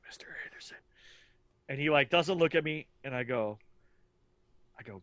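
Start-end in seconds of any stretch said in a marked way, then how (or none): 0.1-0.8 a hushed, secretive whisper of the name. Retold or imitated. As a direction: whisper it across the waiting area, trying not to be overheard. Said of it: "like, whispering".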